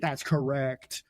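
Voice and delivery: slow, drawn-out quizmaster confirmation. Pretend. Host confirming the match score, slow and drawn out.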